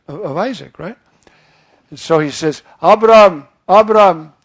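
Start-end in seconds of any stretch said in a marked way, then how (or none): 2.8-4.3 calling out. Acted. God calling Abram.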